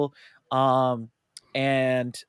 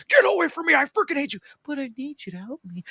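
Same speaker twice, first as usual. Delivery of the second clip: much higher, put-on villain voice; hammed-up mad scientist snapping; mock Doc Ock rant; 12 semitones higher than usual. Imitating Doctor Octopus ranting.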